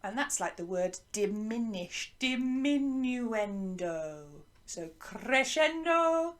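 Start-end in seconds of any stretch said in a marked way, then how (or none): 2.2-4.3 "diminuendo" drawn out and sliding down; slow, fading say-after-me "diminuendo"; getting softer. Shown as a demonstration. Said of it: "is getting softer". 5.0-6.3 "crescendo" stretched, rising in pitch and volume; slow, climbing "crescendo"; getting louder. Shown as a demonstration. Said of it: "getting louder".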